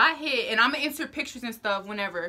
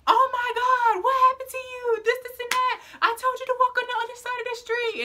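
high-pitched shrieking mom impression; mock screech, loud at first, held high throughout; frantic scolding mom; 4 dB louder than usual. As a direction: imitate a hysterical mother scolding, pitched way up. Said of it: "my mom was not having it".

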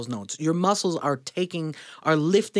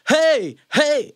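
high, loud punchy "hey"; pushed-out yell; 11 semitones higher, 6 dB louder than usual. Shown as a demonstration. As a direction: shoot the air out on each yell. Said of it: "he's saying you gotta go".

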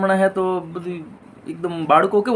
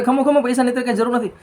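hurried doctor; 8 semitones higher, faster than usual. Acted. Doctor stops patient paying.

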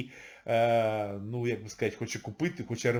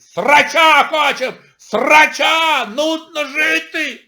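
loud, higher mock shouts, drawn out; mock yelling crowd; 10 semitones higher, 10 dB louder, much slower than usual. Mocking people who want a fight, loud mock yells. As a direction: yell like a bored mob. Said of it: "Говорить".

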